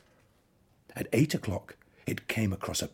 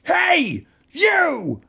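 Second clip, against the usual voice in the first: challenging shout; 12 dB louder than usual. A narrator's reading. Ladybird picks a fight.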